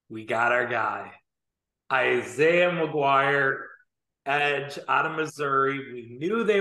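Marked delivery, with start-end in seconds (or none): 1.9-3.6 "Isaiah McGuire" stretched very slowly, each syllable savoured; drawn-out name announcement. Real, not acted. Podcast host proudly reveals the fan favourite's draft pick, stretched slow.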